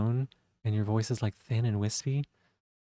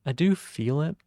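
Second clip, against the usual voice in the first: "I do feel it" lifted higher with "do" rising, a bit louder; lighter feminine take; 5 semitones higher, 3 dB louder than usual. Shown as a demonstration. Streamer trying out a lighter, higher feminine ending. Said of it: "I feel like I may be doing that".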